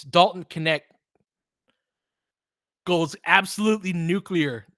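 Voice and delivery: running game call, steady and informative; play-by-play. Acted. Fan calling the game for listeners, play-by-play. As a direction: call the action like a broadcaster.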